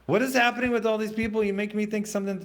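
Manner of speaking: startled outburst at the chat; alarmed. Real, not acted. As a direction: blurt it out in disbelief.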